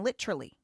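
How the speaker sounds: LITERALLY squeezed to "lit-truly"; kids' clipped-syllable version. Retold or imitated. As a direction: imitate LITERALLY with a hard T, as "litch-rully". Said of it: "American kids are saying".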